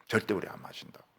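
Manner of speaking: soft unvoiced whisper; whispered firm refusal; hushed vow. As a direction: breathe the vow quietly. Voicing the Rechabites' vow.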